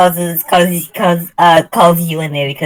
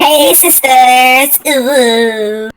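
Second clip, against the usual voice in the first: slow voice changer greeting; 5 semitones higher, 3 dB louder, much slower than usual. Pretend. Repeats quote through voice mod.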